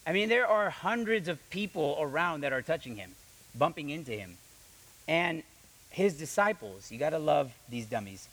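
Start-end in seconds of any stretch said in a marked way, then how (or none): none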